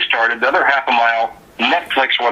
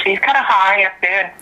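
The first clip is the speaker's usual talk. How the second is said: Carl's high voice; 7 semitones higher than usual. Shown as a demonstration. Guest demonstrating [name]'s speaking voice, high. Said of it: "You see, he talks like this".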